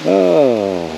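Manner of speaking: ordinary talk, nothing marked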